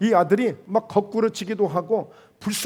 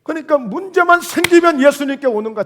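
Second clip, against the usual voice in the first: exasperated preaching; 5 semitones higher, 6 dB louder than usual. Real, not acted.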